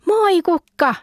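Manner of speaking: high, bright call across the yard; excited high greeting; shouting hello. A narrator's reading. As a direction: call out to your cousin in delight. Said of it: "minä huusin".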